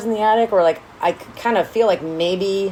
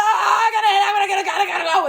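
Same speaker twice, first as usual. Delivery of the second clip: high-pitched stammering fluster; hammed-up panic, frantic to get away; mock nervous panic; 11 semitones higher than usual. Host imitating Laura Sue fleeing the will reading, mock nervous panic. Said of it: "very fucking nervous about this".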